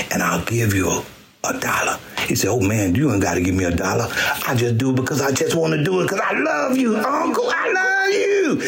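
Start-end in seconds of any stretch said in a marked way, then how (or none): 0.1-2.0 hushed offer of a dollar; whispered bribe. Retold or imitated. Whispering a dollar bribe to the nephew. 2.5-8.6 nephew's voice. Retold or imitated.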